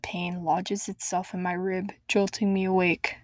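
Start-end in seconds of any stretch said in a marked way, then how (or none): none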